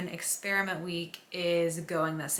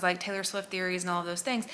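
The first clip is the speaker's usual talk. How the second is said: usual speaking voice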